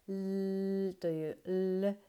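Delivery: ル drawn out slowly with the tongue tip on the back of the front teeth; 英語のLで「ル」; English L sound. Shown as a demonstration. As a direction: sound ル as an English L, slow and deliberate. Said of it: "ルという音になります".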